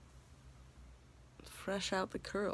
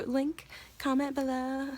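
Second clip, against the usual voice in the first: mock youtuber; 5 semitones higher, 3 dB louder than usual.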